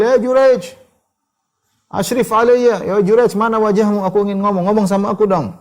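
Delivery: high, loud repeated calling; mother calling again. Retold or imitated. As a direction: keep calling, longing to see his face. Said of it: "Dia panggil-panggil".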